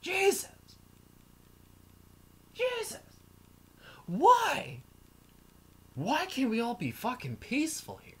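mock despairing cry, pitch climbing high on each Jesus; rising wail of disbelief; exasperated outburst. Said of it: "And I was like".

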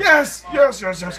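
loud, high victory shout; triumphant cheer. Real, not acted. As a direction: celebrate the win. Triumphant success.

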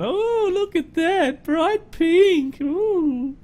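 high-pitched drawn-out cooing; mock oohing. Truck reviewer showing off a bright pink paint job, high mock cooing. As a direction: ooh over it in a silly high voice.

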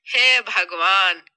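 slow, low, loud lament; exasperated mother. Acted. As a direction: drag it out in loud despair. Mother despairs at her foolish daughter. (माँ की धीमी रफ़्तार में हताश आह)

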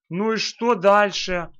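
usual speaking voice

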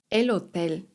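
"hotel" said the Spanish way, as "otel"; silent H. Shown as a demonstration. Teacher introducing Spanish word for hotel, silent H in "hotel". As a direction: drop the H in "El hotel". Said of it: "litera H jest niema".

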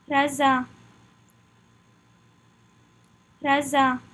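French "Raisin", French r and nasal ending with silent n; "Raisin" modelled the French way for learners; French pronunciation drill. Shown as a demonstration. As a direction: say "Raisin" as "reh-zan" in French. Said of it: "Practice saying it with me".

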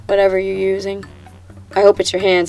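normal talking voice

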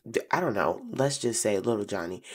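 usual speaking voice

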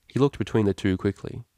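plain reading voice

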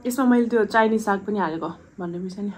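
talking while eating; chewing food. Real, not acted. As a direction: mouth partly full. Seen 0: Eats noodles before; brings noodles into open mouth while speaking.

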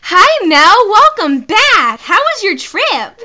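loud, high, drawn-out greeting; over-the-top high game character. Acted. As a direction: sing out the greeting in a high, swooping voice. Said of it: "You gave him the best voice".

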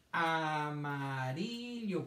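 AMARILLO drawn out very slowly, syllables linked. Shown as a demonstration. Teacher sounding out amarillo for children to count, syllable by syllable. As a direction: stretch each syllable of AMARILLO. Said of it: "¿Cuántas sílabas me dijeron".

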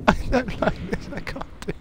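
high, squealing, panicked protest; real panic. Real, not acted. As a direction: squeal it out in terror, overwhelmed. Fleeing enemy in panic.

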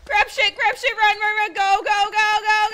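hurrying herself out; frantic run calls; urgent shouting. Real, not acted. Fleeing the exploded house.

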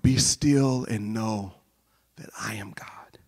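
slow, hushed, fading into a whisper; meditative scripture recitation. Shown as a demonstration. Pastor guiding a quick meditation, hushed and slow. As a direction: let the verse sink in softly. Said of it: "So you could start with".

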